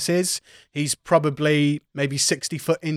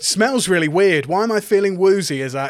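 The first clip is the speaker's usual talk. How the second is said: a hint of a dazed, woozy Titus, louder; mock-puzzled musing, a little louder; woozy character voice; 4 semitones higher, 6 dB louder than usual. GM voicing Titus burning the torch, lightly woozy. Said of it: "being like".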